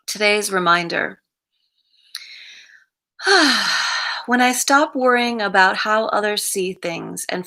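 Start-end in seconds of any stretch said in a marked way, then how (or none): 3.2-4.2 long audible exhale; sigh. Real, not acted. Reader about to give today's reminder, sighing. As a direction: breathe out in an open sigh. Sighs before the day's reminder.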